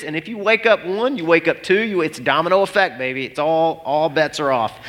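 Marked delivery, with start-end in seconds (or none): none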